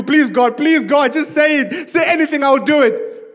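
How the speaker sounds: high, loud, breathless bargaining; frantic pleading. Acted. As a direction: beg for your life in a tumbling rush. Jack frantically bargains with God.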